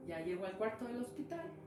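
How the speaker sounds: ordinary delivery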